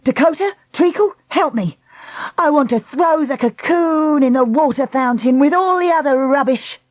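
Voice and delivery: high, urgent call. Acted. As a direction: call them over. Lucy calls the girls.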